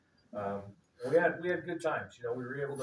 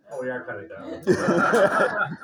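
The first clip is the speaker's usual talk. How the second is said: slow, loud, laughing admission; admitting to the crowd he and his brother are dumb, laughing; 2 semitones higher, 13 dB louder, much slower than usual. Real, not acted. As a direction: laugh it out at yourself. Laughs at himself.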